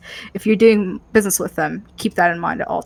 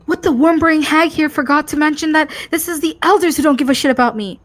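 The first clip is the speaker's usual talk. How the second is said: impatient Hope voice; 7 semitones higher than usual. Acted. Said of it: "a frantic or, like, a impatient hope voice".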